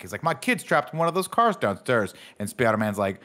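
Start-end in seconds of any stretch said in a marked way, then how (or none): 0.2-2.1 retold yell. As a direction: imitate a panicked father calling back. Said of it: "Thomas Howell yells back".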